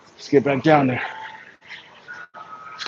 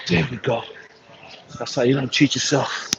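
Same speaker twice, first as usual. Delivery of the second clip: usual speaking voice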